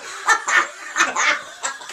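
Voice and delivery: high, breathy burst of laughter; loud laugh. Real, not acted. Laughs at heckle joke.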